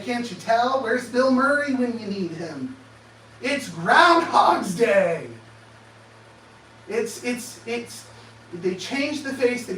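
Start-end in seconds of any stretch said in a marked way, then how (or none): 3.4-5.3 slow, loud drawn-out shout. Real, not acted. To the audience, exasperated, a slow loud shout. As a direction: bellow it out in exasperation. Repeats the line as an outcry.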